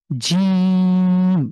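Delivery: "জিম" held long and loud, the vowel stretched out firm; long jim. Shown as a demonstration. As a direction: stretch "জিম" for a long count, said hard and strong. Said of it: "জিম শক্ত করে".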